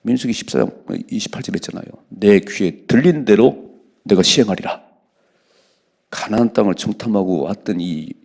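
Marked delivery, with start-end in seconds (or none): 2.1-4.8 loud, slow, solemn reading; scripture reading. Acted. Preacher reading the verse loudly to his congregation. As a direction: proclaim the verse slowly and heavily.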